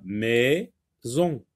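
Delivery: "maison" split slowly into mai... zon; syllable by syllable. Shown as a demonstration. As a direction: leave a long gap inside "maison", between mai and zon. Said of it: "le sectionner en syllabes".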